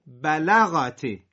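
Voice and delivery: بلغتی slow and loud, each syllable held apart. Shown as a demonstration. Teacher models the split reading of بلغتی for the class. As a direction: stretch out بلغتی beat by beat. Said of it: "سر هم بخونید".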